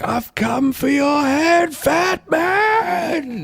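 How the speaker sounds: very high, long-held yelled threat; full-voiced mock battle cry; Goggins. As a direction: holler it slowly, stretching the final words out. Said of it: "I like where Goggins is like".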